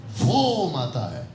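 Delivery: slow, long "वो" falling away; stress on वो. Shown as a demonstration. Preacher repeating for those who missed it, "वो" stretched. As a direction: stretch "वो", then drop on "माता है". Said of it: "आप नहीं समझे?".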